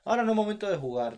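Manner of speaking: playful fast telling-off; mock scolding. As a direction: snap at her like a fed-up parent. Scolding partner like a child.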